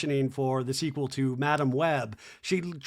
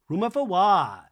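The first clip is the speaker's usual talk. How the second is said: raised, emphatic challenge; louder mock indignation; 3 semitones higher, 4 dB louder than usual. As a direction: push the question up in mock outrage. Mimicking Lisa's indignant challenge, second take.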